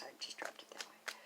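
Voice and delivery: whispered aside. Real, not acted. Aside about dropping the card.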